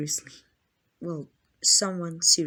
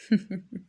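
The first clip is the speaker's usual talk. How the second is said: sinister chuckle. Acted. Vampire alone with trapped hunter, gloating chuckle. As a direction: chuckle to yourself, in control.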